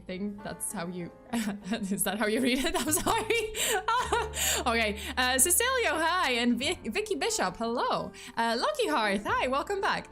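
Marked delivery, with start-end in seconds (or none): none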